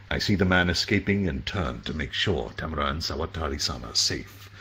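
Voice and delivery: ordinary delivery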